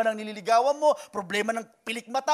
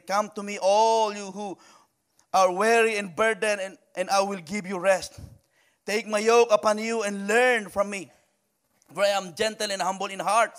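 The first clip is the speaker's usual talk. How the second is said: scripture reading; 6 dB louder than usual. Real, not acted. Reading Jesus' words in Matthew.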